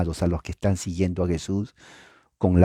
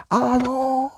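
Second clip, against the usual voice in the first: very high hailing call; high valley shout. Shown as a demonstration. Preacher recalls shouting with friends in the valley, very high call. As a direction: yell it up into the valley. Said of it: "comenzábamos a gritar".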